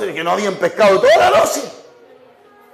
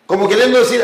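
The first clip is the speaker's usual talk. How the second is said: normal talking voice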